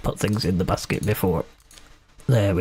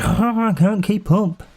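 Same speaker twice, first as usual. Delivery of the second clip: flustered cashier; 10 semitones higher than usual. Retold or imitated.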